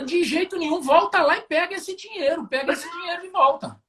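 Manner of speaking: voz da tia bem mais aguda, rindo enquanto imita; imitando a tia Ana. Retold or imitated. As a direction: faz a tia indignada mandando buscar o dinheiro. (contando rindo a bronca da tia ao funcionário)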